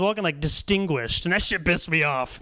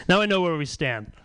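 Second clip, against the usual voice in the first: ordinary talk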